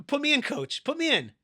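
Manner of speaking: loud, high-pitched appeal; acted player begging to play; eager pleading. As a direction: call out to the coach.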